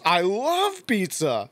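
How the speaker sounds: high, slow, stretched-out enthusiasm; Brian Tochi. Retold or imitated. Actor answering a little fan as her turtle hero, high and drawn out. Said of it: "And he, he goes".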